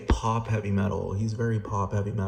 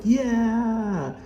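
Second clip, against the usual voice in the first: high squeaky gleeful fans, sing-song la la; mocking cheering fans; 13 semitones higher, 3 dB louder than usual. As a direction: cheer like giddy fans celebrating. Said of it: "everyone will be like".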